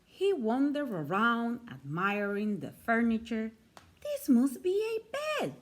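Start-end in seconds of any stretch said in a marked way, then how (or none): 4.0-5.6 much higher, delighted teddy bear voice; a toy bear marvelling at a bed; delighted little bear. A narrator's reading. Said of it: "he said".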